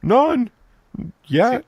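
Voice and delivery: very high, loud mock innocent answer; squeaky innocent kid. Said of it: "I'm like".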